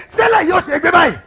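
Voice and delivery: high, fast worried question; anxious friend. Retold or imitated. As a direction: imitate the frightened pastor. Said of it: "said to me in the Yoruba language".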